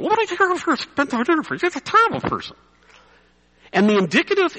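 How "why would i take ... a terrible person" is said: much higher, fast, scoffing put-on voice; mock a stubborn churchgoer's outraged refusal; indignant listener. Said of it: "The indicative for you is".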